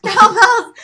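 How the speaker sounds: high, loud laughing cry; laughing shriek. Retold or imitated. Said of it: "I screamed".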